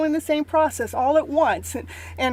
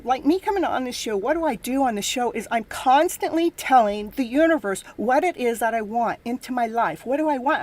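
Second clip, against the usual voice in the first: usual speaking voice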